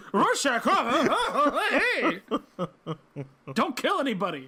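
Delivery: high, loud, jittery mock laughter; hammed-up nervous bar owner; nervous greeting. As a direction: fake a panicky chuckle to placate someone dangerous.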